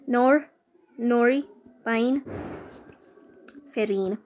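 "नोरीपाइनफ्रिन" spelled out very slowly in a put-on English accent. Shown as a demonstration. Teacher jokingly says "नोरीपाइनफ्रिन" to students in a put-on English accent. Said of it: "मैं accent use कर रही हूँ अंग्रेजी बोलने के लिए".

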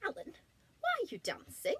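high, puzzled friendly voice; curious giraffe voice. Acted. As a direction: ask it wide-eyed. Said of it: "asked Giraffe".

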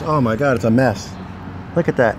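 ordinary talk

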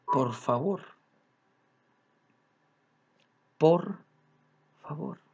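"Por favor" said softly and slowly, deliberately kind; soft, careful "Por favor" as a conscious courtesy; quiet, considerate "Por favor"; gentle polite request. Shown as a demonstration. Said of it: "así bien consciente".

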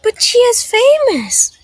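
high, loud voice of an astonished pupil; amazed protest. Acted. Nina to teacher about the visiting chef, amazed. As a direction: pitch it way up in disbelief.

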